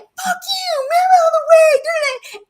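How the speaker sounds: high, loud mock yell at other drivers; hammed-up churchgoer cursing in traffic; mock road rage. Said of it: "they're like".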